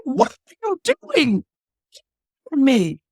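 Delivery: a mother's acted shriek of outrage; horrified outcry. Queen snatches baby from fire.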